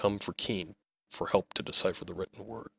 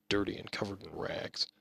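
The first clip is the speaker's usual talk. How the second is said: plain reading voice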